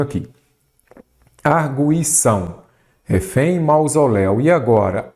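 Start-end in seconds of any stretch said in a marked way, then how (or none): none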